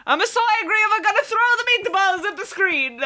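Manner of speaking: high, fast mock Italian rant through laughter; laughing mock-Italian rant about meatballs; hammed-up Italian. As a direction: ham up an angry Italian, laughing.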